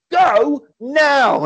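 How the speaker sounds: high, loud "Go now"; hammy urgent shout. Retold or imitated. Old man hurrying the heroes, mock urgent shout. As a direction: bark the order in mock panic.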